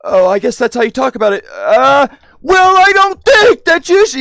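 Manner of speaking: put-on high, loud childish voice, speeding up; hammed-up toddler reasoning with yelling parents; mock little kid. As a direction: play a logical three-year-old talking back. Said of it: "logical as a fucking three-year-old".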